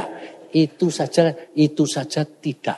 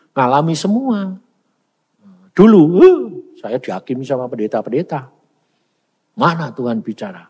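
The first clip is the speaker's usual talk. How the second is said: ordinary delivery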